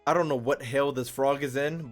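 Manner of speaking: ordinary talk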